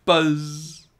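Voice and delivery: put-on high, buzzy fly calling the boy's name; buzzing fly voice. A narrator's reading. Pet fly calling his boy's name in a high buzzy voice. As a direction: buzz the word Buzz like a pet fly. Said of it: "Fly Guy could say the boy's name".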